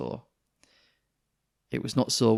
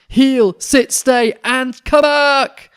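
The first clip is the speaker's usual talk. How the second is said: very loud, high, slow yelled orders; loud, high, drawn-out commands; shouted commands; 18 dB louder, much slower than usual. Acted. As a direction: bellow at the dogs to come back. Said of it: "The dog's people shouted".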